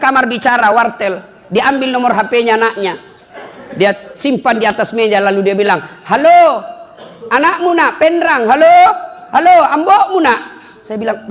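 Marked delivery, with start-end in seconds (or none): 6.1-10.4 high, loud imitation of a villager on the phone; phone shouting. Villager calling his child from a phone booth, mock shouting. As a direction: holler hello as if the line is bad.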